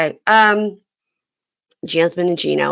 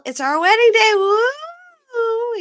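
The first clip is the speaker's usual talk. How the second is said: hammed-up high bridal cheer; mock wedding excitement; much slower than usual. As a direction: whoop it up in a very high put-on voice. Acting out arrival at the chapel.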